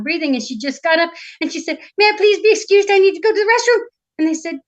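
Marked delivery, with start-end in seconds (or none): 2.0-3.8 high, loud, rushed plea; urgent, high-pitched request to get away; flustered princess excusing herself. Acted. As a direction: blurt out a desperate polite excuse. Princess fleeing her parents' serious discussion.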